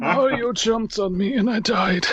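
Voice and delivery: put-on high old man voice; old-timer voice. Friend mocking aging gamers, high old voice. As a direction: play a feeble grandpa.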